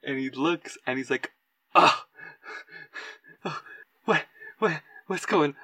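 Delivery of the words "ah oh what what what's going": startled jump. Acted. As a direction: play someone jumping in fright, mildly. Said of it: "he jumps and he screams".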